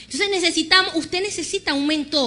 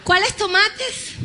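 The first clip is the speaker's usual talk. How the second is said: slow punchline; 6 semitones higher, 4 dB louder, much slower than usual. Shown as a demonstration. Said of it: "Pregúnteme cómo salí".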